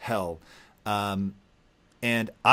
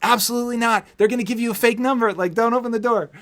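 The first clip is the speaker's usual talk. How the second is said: much higher, fast frantic mom voice; panicked mom; 11 semitones higher, 4 dB louder, faster than usual. Retold or imitated. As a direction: play the mother freaking out on the phone. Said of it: "my mom's freaking out".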